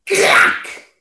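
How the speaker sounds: loud crack. A narrator's reading. Tree breaks.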